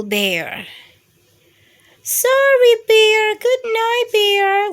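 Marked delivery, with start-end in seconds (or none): none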